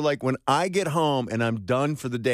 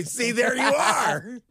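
mock exasperated; 12 semitones higher, much slower than usual. Real, not acted. Joking mock outrage at cohost.

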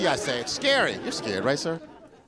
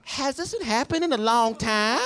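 put-on girlfriend; 8 semitones higher than usual. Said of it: "she actually looked me in my face".

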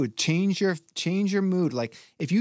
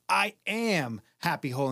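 "I am" stretched out and raised; emphasize each word; 3 semitones higher than usual. Shown as a demonstration. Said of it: "you emphasize each word".

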